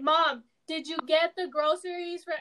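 over-project each word to mom; mock yelling. Shown as a demonstration. Daughter asking mom about groceries, mock yelling. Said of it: "Why are you yelling?".

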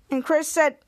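ordinary talk

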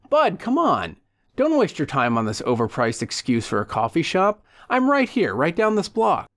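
pushy, chummy sales pitch with high leaps; lively, insistent invitation; over-friendly old pal Leo. Acted. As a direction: coax a reluctant buddy along.

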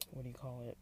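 quiet words after a gulp; talking while drinking water; while sipping a drink. Real, not acted. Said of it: "I'm drinking a lot of water right now".

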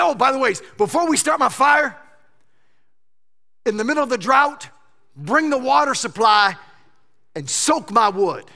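bold loud command. Pretend. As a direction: order them to drench it with swagger. Elijah boldly orders water poured.

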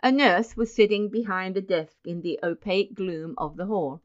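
usual speaking voice

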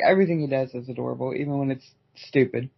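ordinary delivery

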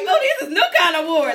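high, loud, laughing quote; laughing surprise. Retold or imitated. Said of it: "I was like".

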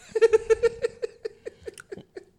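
high burst of laughter; loud laugh. Real, not acted.

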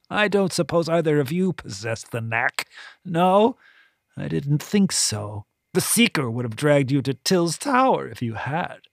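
put-on high, disdainful old woman voice; a scornful, self-pitying matron; bitter voluptuary. A narrator's reading. Voluptuary character voice.

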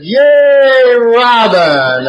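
jokingly cheering Robin's name. Retold or imitated. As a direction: call out the name like a happy cheer. Richard cheering her name.

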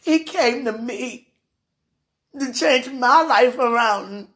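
usual speaking voice

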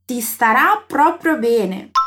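slow, clearly spaced words; slow model phrase. Shown as a demonstration. Teacher shows a compliment in Italian, slowly. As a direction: lay each word out for the learner. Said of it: "Agora sim a frase todinha".

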